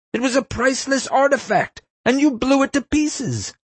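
outraged screech, climbing as it goes; screaming tirade. A narrator's reading. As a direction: rage at the culprit as a shrieking principal. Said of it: "the principal screamed".

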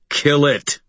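harder. Acted. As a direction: bark the order harder. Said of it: "his voice harder".

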